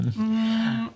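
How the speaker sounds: long pondering hum; thinking sound. Shown as a demonstration. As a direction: draw out "mm" as if considering. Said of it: "can you make a thinking sound".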